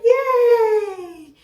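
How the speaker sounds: high, loud, drawn-out cheer; mock celebration. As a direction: let out a long high yay. Acting out good scale day.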